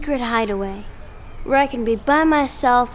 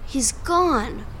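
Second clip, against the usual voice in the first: normal talking voice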